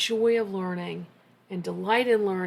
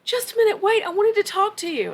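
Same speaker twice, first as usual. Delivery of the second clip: retold yelling; 11 semitones higher, 6 dB louder than usual. Professor stopping parents as they head home, imitated yelling. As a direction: imitate a high, raised-voice call. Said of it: "I hear somebody yelling".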